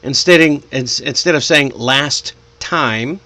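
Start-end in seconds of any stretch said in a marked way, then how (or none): 1.8-3.2 "last time" as two separate words, gap between; unlinked. Shown as a demonstration. Teacher shows form before linking, separated words. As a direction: finish "last" fully, then say "time". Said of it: "you can shorten it".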